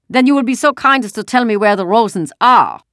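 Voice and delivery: put-on loud, high accented soldier order; booming soldier, not caring who wakes; loud soldier demand. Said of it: "He made no effort to lower his voice".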